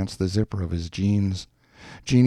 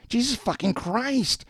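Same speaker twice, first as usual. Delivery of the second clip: very high, thin squeal of amazement; high-register scream. A narrator's reading. Woman amazed by the check, restrained high scream. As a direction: exclaim way up high, held back in volume. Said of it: "she screamed in a high register".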